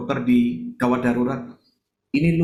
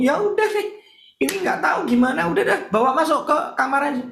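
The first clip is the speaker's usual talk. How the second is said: much higher, flustered giving up; bewildered doctor; 13 semitones higher than usual. Retold or imitated. Confused doctor sending the boy back, high flustered. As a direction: throw up your hands in confusion. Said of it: "Dokternya kaget, bingung".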